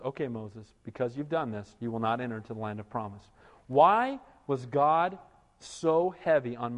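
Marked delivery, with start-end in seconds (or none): 0.0-3.3 quiet verdict. Retold or imitated. God pronouncing judgment quietly.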